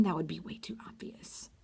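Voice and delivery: breathy secret whisper; whispered aside. Retold or imitated. Imitating Olivia whispering that the roses would be too obvious. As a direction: drop to a whisper as if sharing a secret.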